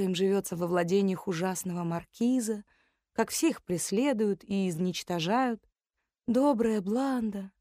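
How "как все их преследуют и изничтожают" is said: ordinary delivery, nothing marked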